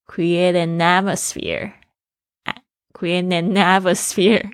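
slow "create an atmosphere", n joined to the vowel as "natmosphere"; linked sounds. Shown as a demonstration. Teacher demonstrating the liaison for learners, linked sounds. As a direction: slowly blend "an atmosphere" into one word. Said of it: "结合在一起".

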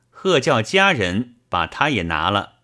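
ordinary delivery

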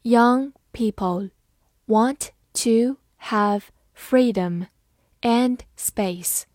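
slow, word-by-word reading; deliberate, separated words for learners; slow model reading. Shown as a demonstration. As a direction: read it out slowly with gaps between words. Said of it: "慢读一遍".

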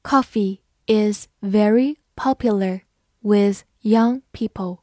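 slow, careful read-aloud, each word clear. Shown as a demonstration. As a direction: read it slowly for learners. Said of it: "好，慢读一遍。". (慢速朗读)

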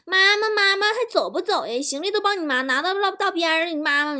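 put-on high-pitched kids calling for mum; children. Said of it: "儿子和女儿从外面回来说".

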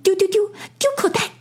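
very high, rapid squeak; quick, high-pitched mouse voice; greedy field mouse. A narrator's reading. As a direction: chatter fast as a covetous little mouse. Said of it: "一只田鼠馋兮兮地说".

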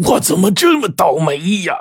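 high-pitched lament of dismay; horrified wail. A narrator's reading. Robber lamenting his luck on opening the bag, horrified.

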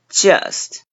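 just with a flat, slightly spread vowel; 嘴巴拉平发just; just correctly. Shown as a demonstration. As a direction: keep the lips spread on just. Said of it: "第二个难词，just".

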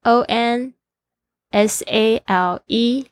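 careful letter-by-letter dictation; slow spelling. Shown as a demonstration. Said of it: "这是两个字". (慢速拼写字母)

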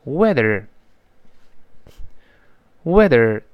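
weather slowly, with an American r at the end; slow read-along weather with voiced th and final r; curled-back er ending. Shown as a demonstration. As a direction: draw out weather and curl the tongue back on er. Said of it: "注意那一二要勾回来".

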